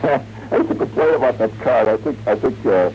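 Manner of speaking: ordinary talk, nothing marked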